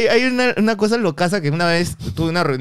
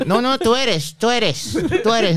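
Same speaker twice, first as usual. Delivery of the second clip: higher-pitched, pressing insistence; insistent recognition; 8 semitones higher, slower than usual. Retold or imitated. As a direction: imitate an excited stranger insisting. Voices the insistent lady who recognised him. (insistencia aguda, imitando a una señora)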